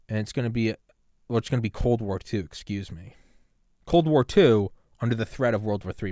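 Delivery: ordinary delivery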